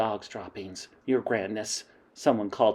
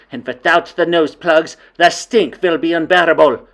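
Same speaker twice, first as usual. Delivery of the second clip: commanding witch queen; 11 semitones higher, 11 dB louder than usual. Acted. Grand High Witch instructing her witches, loud and high. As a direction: lay out the plan with cold authority.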